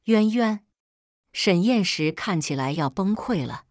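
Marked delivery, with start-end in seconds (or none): 0.1-0.6 sharp, louder call of a name; panicked shout of his name; alarmed cry. A narrator's reading. Cries out as Pei Yuan cuts.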